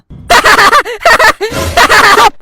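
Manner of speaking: loud giggle. Acted. Child laughs at father.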